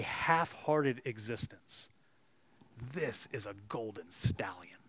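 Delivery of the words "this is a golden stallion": soft, unvoiced awed whisper. Real, not acted. Preacher to congregation, awed whisper. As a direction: lean in and breathe it.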